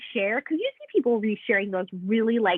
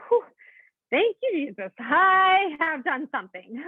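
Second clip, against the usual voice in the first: relieved exclaim; 5 semitones higher, 3 dB louder than usual. Retold or imitated. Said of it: "I'm like".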